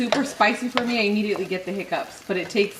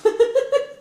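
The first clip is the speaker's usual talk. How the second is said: high burst of laughter; 6 dB louder than usual. Real, not acted. Laughing at joke.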